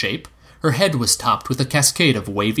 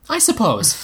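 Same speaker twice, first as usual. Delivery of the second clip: put-on high boyish voice; high-pitched boy's reply; Egg; 11 semitones higher than usual. A narrator's reading. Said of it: "Egg replied".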